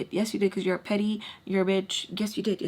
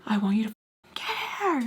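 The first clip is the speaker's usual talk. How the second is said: mock scream. Storyteller imitating her angry ex, mock scream. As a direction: pitch jumps way up on the last word, held-back volume. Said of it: "like, screaming".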